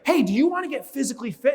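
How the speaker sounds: high, eager call to a group; hyped-up researcher, pitched high; acted rallying pitch. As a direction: pump up the group with the question.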